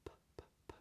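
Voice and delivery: "p, p, p" as puffs of air with no voice; voiceless P. Shown as a demonstration. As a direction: puff out "p, p, p". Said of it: "just air".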